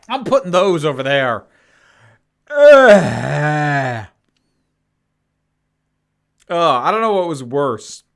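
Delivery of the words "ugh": disgusted groan. Real, not acted. Gagging at bad flavor.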